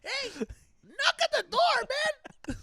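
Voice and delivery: high, slow mock dad shout; imitating dad. Friend mocks the dad banging at the door, high slow shout. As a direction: do the dad's voice way up high and drawn out. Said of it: "He was like".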